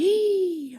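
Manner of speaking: high, drawn gasp; horrified mock gasp. Inner critic discovers the eaten donut, theatrical horrified gasp. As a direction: suck in breath in mock horror. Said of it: "The voice in your head is like".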